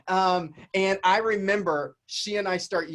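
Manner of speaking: ordinary talk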